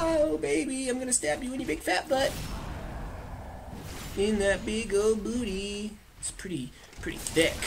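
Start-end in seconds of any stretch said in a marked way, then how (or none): 0.0-6.0 taunting voice. Pretend. Taunting enemy in put-on voice.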